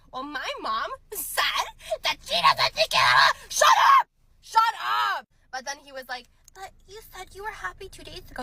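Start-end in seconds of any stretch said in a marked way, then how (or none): 0.1-3.4 higher, louder, slow mocking shout; mocking mama's boy. Girl mocking her ex hiding behind his mom. 3.5-5.3 much higher and louder screamed shut up; shriek. Real, not acted. Girl screaming at her ex's excuse. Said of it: "outburst reacting to the ex". 6.5-8.4 higher and much softer, pitiful; mock whiny ex. Girl imitating her ex's pitiful protest.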